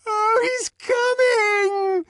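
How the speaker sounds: very high, drawn-out ecstatic scream; shrieked high rapture. A narrator's reading. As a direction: scream it slowly at a piercing pitch. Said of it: "screamed Clara Detterding".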